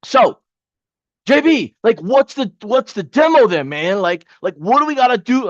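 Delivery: student begging the coach for the demo, hammed up; mock confused student. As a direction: ham up a lost student asking for the demo. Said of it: "I'm adding for emphasis and, and effects here".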